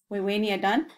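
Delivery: ordinary delivery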